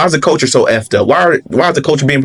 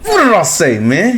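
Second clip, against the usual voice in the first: triumphant; 5 semitones higher than usual. Real, not acted. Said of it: "Hit the nail on the head".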